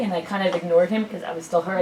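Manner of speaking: ordinary talk, nothing marked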